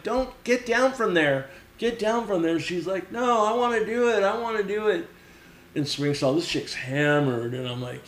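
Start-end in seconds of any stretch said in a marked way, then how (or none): 0.0-2.6 retold urgent call, higher pitched; urgent warning. Girlfriend tells drunk friend to climb down, urgent warning. 3.1-5.1 higher-pitched imitation of stubborn insistence; mock a drunk girl digging in; insistent protest. Drunk girl refusing her girlfriend, insistent protest.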